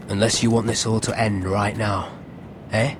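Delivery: menacing, pitch dropped deep; low threat. Acted. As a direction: warn them with controlled menace. Intruder threatens the lord.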